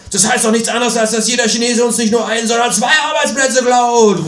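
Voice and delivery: high, outraged shouting; indignant shout. Acted. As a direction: cry it out in outrage. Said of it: "ruft er empört".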